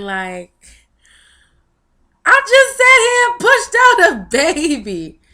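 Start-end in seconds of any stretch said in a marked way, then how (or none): 2.2-5.2 high, loud burst of disbelief; loud, high-pitched marveling; amazed exclamation. Retold or imitated. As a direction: cry it out, astonished at yourself. Exclaiming disbelief at having given birth.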